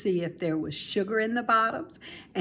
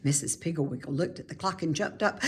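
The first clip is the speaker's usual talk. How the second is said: plain reading voice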